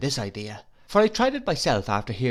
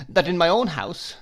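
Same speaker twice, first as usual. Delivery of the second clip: hurt complaint; 7 semitones higher, 4 dB louder than usual. Acted. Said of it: "said my mother".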